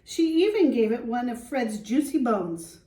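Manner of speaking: put-on lively read-aloud for young children; storybook reading. Teacher reading to the class, storybook voice. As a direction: read it like a picture book to the class.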